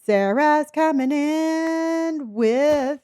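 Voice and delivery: goofy slow announcing voice; odd drawn-out voice. Real, not acted. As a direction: draw the words out in a silly high voice. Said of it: "I don't know why I said it like that".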